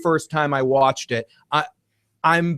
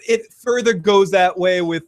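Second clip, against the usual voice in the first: ordinary talk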